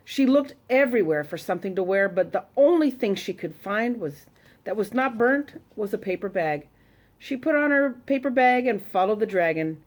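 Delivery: ordinary delivery, nothing marked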